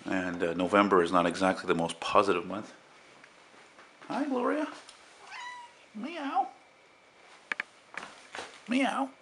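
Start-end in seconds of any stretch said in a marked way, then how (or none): 4.1-4.7 much higher, cooing pet voice; sing-song hello to a pet; talking to the cat. Real, not acted. Greeting his cat. 6.0-9.1 high-pitched meow at the cat; cat meow in a cooing voice; imitated meow. Meowing at his cat.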